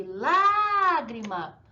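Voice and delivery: lágrima with LÁ drawn out and loud; stronger stressed syllable. Shown as a demonstration. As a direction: stretch the first syllable of "lágrima". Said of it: "o acento é a mais forte".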